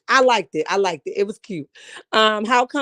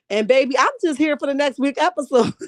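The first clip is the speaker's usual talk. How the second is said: ordinary delivery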